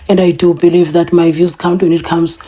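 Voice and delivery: ordinary talk, nothing marked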